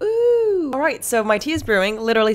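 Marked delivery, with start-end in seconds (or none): 0.0-0.7 gasp of delight. Real, not acted. Drops tea in hot water.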